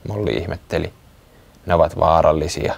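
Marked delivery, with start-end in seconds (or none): none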